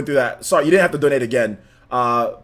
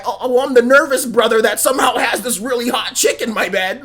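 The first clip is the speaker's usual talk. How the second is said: mocking quote; 8 semitones higher than usual. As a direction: shout it like a jittery, smug guy. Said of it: "And the other guy".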